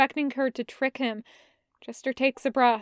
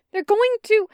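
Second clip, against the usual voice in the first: higher, louder burst; loud break; 10 semitones higher, 7 dB louder than usual. Acted. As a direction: blurt it out before it breaks. Jester pleading, loud start.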